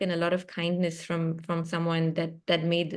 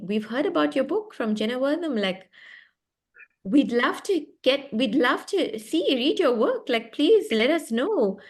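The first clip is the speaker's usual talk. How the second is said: eager agents imitated; 5 semitones higher than usual. Author retelling three New York agents' emails, imitated eager gushing. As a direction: imitate agents keen to read, pitched up.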